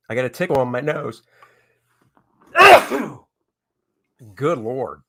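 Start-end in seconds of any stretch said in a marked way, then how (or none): 2.5-3.1 sudden loud, high sneeze. Real, not acted. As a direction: let out a big sneeze. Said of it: "Oh, I gotta sneeze".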